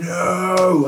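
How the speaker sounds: sudden high yelped No, loud; a pained shout of defeat; anguished no. Retold or imitated. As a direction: cry out in dismay at having to stop. Gutted at stopping in the marathon.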